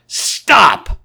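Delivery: a high, loud, sudden bellow; violent shout. Acted. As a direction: cut her off with one furious yell. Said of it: "with such extraordinary violence".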